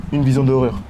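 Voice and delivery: ordinary talk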